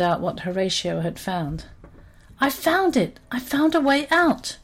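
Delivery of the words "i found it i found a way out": much higher, bursting with excitement; triumphant cry of discovery; excited shout. A narrator's reading. Horatio returns to the trapped monkeys, excitedly announcing escape. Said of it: "Horatio was so excited".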